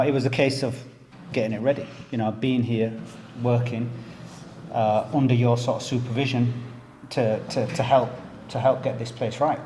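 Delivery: usual speaking voice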